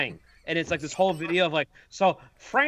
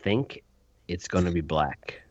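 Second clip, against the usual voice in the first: low, hushed deadpan whisper; mock secret whisper; 12 semitones lower than usual. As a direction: whisper it like a spoiler.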